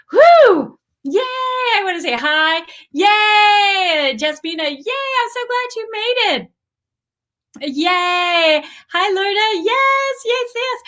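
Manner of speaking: greeting arriving viewers on a live stream, high squealing excitement; excited squealing greetings. Real, not acted. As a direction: gush over every viewer who just joined.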